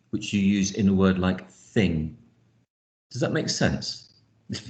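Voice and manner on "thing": "thing" begun with a voiceless th, no buzz; unvoiced th. Shown as a demonstration. Teacher giving the contrasting example word, voiceless th. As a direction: open "thing" on breath alone. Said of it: "And that's different from the".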